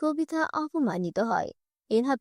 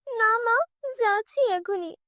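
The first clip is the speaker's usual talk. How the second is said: put-on meek girl; 8 semitones higher than usual. Girl answers stepmother.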